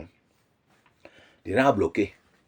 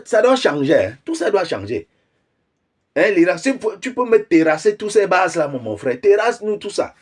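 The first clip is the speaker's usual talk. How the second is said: higher and louder, worked-up cheering; worked-up rant; 8 semitones higher, 3 dB louder than usual. Real, not acted. Ranting to followers, cheering Iran on, higher and louder. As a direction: egg Iran on, voice climbing.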